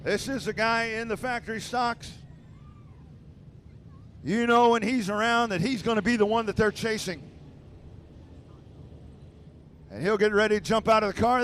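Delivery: booming PA announcer call; race announcer. Acted. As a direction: announce the finish to the grandstand. PA announcer calling race finish.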